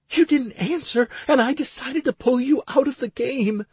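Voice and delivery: high, upset woman's voice; distressed, pitched well above the narration; Stacy. A narrator's reading. Crying wife explaining to her husband, upset.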